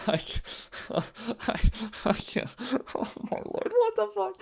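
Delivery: breathless laughing, then a squeaky high outburst; helpless laughter. Real, not acted. Fan cracking up at his team's collapse, helpless laughter. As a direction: laugh until you can't talk.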